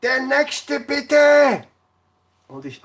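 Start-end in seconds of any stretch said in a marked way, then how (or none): 0.0-1.6 slow, drawn-out loud call from far back; calling out next. Retold or imitated. Customs officer calls from the back of the hall, drawn-out shout. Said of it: "schreit eine Troller heraus".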